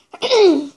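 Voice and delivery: loud throat clear. Real, not acted. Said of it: "j'ai le rhume".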